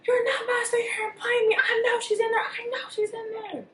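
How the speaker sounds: very high, loud screaming yell; furious yelling. Retold or imitated. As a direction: scream at him through the door. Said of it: "I yelled like fuck that day".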